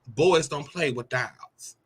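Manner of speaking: a hint of a disapproving father; stern father quote. Landlord scolds the little boy, slow stern. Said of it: "and he was like".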